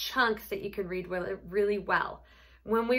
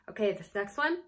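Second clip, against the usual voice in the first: ordinary talk, nothing marked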